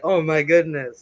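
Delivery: imitating a frightened husband; scared. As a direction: gasp it out as if alarmed. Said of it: "scared, like".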